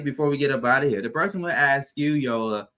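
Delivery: ordinary delivery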